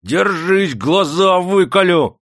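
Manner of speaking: high, loud, stretched yell; shouted threat. A narrator's reading. Master tests whether old man blinks, shouting threat. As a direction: yell to terrify into flinching. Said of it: "закричал хозяин".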